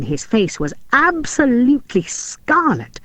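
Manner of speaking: ordinary delivery, nothing marked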